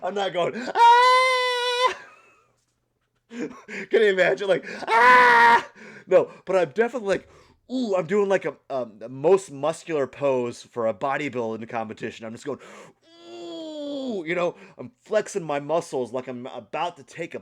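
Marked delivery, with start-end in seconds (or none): 0.8-2.0 loud high shriek; mock scream. Hit by cold shower water, loud high yelp. 4.9-5.7 loud high shriek; mock scream. Hit by cold shower water, loud high yelp. 13.3-14.2 high ooh. Retold or imitated. Bracing for cold.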